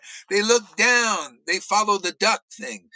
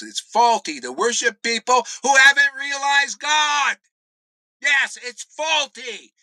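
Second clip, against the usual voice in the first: angry shout; 6 semitones higher, 5 dB louder than usual. Real, not acted. Ranting against idol worship.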